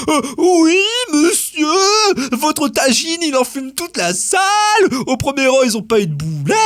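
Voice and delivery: high-pitched complaining put-on voice; imitated cinema complainer. Cinema staff complaining about a smoky tagine, high indignant imitation. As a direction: play a scandalised staffer in a high voice.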